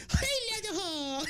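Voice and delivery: high-pitched drawn-out camp salute; camp mock Nazi. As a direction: call out slowly in a high, camp German voice. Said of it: "Hitler salute with the limp wrists".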